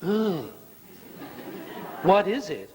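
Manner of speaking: disgusted ugh. Shown as a demonstration. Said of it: "don't say".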